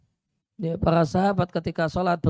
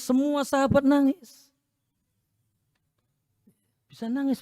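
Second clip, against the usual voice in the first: amazed; 7 semitones higher than usual. Real, not acted. Marvelling at weeping companions.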